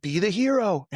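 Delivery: high, hammed-up heroic call; mocking the hero men are told to be; mock movie hero. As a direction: parody the action-movie voice. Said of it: "you know, from movies and on".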